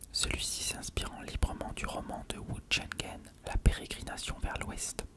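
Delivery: usual speaking voice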